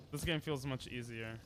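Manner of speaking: usual speaking voice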